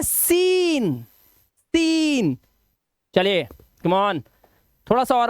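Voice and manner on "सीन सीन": "सीन" high, with a very long ee; slow, raised "सीन"; long vowel. Shown as a demonstration. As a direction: stretch "सीन" right out. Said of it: "आपको क्या बोलना है".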